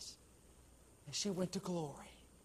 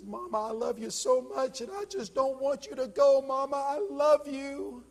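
crying daughter; 11 semitones higher, 10 dB louder than usual. A narrator's reading. Daughter crying at her dying mother's bedside, high and breaking. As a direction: plead with her dying mother through sobs. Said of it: "She was just uncontrollable crying".

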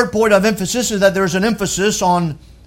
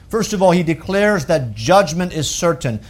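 ordinary talk

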